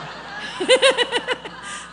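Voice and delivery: loud high-pitched laugh; high burst of laughter. Real, not acted. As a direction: let a big laugh break out. Laugh at own reaction.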